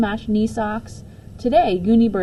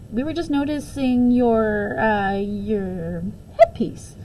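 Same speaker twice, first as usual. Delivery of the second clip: slow, hesitant teacher searching for a polite word; teacher tactfully remarks on a girl's odd hat; 2 semitones higher, slower than usual. Acted. Slow, hesitant tact.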